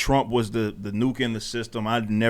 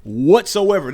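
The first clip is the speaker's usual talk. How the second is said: louder, higher and slow, every word pressed out; rising anger; 6 semitones higher, 7 dB louder, slower than usual. Real, not acted. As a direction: let the frustration boil over. Heated rant about the government.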